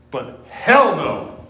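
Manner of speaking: "hell no" slow, hit hard; emphatic hell no. Retold or imitated. Preacher quoting the Southern translation, punched "hell no". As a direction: drag out "hell no" with force. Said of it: "they translated as not just no".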